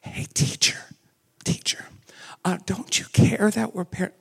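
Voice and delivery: breathy whisper, barely voiced; whispered plea. Preacher mocking how we read the disciples, hushed whisper. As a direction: whisper it like a timid reader. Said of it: "we're like".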